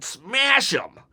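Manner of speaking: a half-hearted yelled command; retold yell. Jey Uso urging Roman to smash Punk, mock yell.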